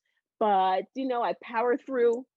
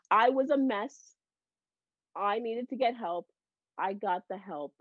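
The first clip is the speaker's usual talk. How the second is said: ordinary delivery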